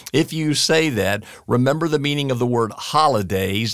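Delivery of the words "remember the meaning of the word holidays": usual speaking voice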